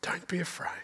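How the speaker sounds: very soft, hushed reassurance; tender whisper. Acted. Jesus gently raises his terrified disciples, in a tender whisper. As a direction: whisper it gently, as if lifting someone up. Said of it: "And Jesus says".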